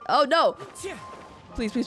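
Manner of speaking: ordinary talk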